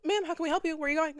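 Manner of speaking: high, bright customer-service voice; chirpy high store associate; sugary staff voice. Retold or imitated. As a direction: mimic an overly eager store greeter. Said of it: "And they're like".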